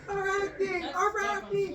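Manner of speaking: high-pitched mock crowd chant; crowd cheering. Storyteller as crowd rooting for a weaver, mock cheering. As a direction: cheer for your favourite in a squeaky high voice. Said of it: "they were cheering back and forth".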